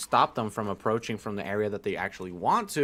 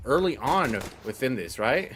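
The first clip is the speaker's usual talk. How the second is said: ordinary delivery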